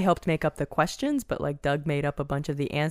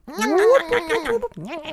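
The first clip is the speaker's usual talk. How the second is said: Curly whoop; much slower than usual. Retold or imitated.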